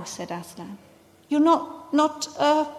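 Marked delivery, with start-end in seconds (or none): 1.3-2.6 higher, faltering and hesitant; halting, a touch of fear; shaky voice. A narrator's reading. Susan can't say the word ghost, shaky and faltering. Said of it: "said Susan in a shaky voice".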